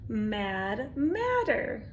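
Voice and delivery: ordinary delivery, nothing marked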